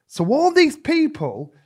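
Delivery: ordinary talk, nothing marked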